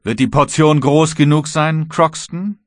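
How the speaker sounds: high, loud taunt rising at the end; threatening question. Acted. Kapitän droht dem Matrosen höhnisch. (laute, drohende Frage)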